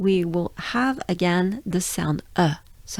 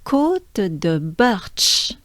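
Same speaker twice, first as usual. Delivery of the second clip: Côte de Birch very slow; very slow model; much slower than usual. Shown as a demonstration. Teacher introducing the climb name slowly.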